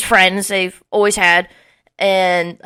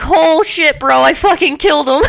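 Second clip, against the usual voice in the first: mock panic; 6 semitones higher, 3 dB louder than usual. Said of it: "And I'm like".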